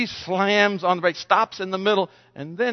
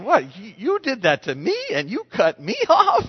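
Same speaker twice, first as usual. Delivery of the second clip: indignant disbelief; 4 semitones higher than usual. Retold or imitated. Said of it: "And I'm thinking".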